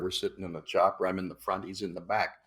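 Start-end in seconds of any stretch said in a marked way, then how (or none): none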